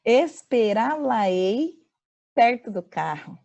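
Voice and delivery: "Esperá-la-ei" said very slowly, each piece apart; "Esperá-la-ei" drawn out syllable by syllable; slow mesoclisis. Shown as a demonstration. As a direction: lay out "Esperá-la-ei" slowly for the class. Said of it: "Não?".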